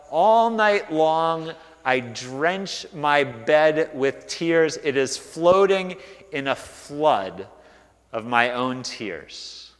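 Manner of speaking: slow, heavy reading of a lament; slow grieving psalm read; mournful lament. Acted. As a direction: let each word of grief sink.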